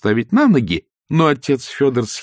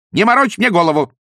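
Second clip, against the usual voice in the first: irritated wife; 11 semitones higher, 5 dB louder than usual. Acted. Wife scolding husband.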